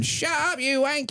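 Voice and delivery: high-pitched yell without real volume; mock distant yell. Londoner telling off a night-time screamer, mock high yell. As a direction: imitate an angry neighbour yelling down the road. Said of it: "I could hear people going".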